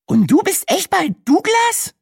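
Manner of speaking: very high, slow character voice; Brenda's high voice. A narrator's reading. Brenda asks Sina again about her Douglas job, in a very high voice. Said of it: "wiederholte Brenda".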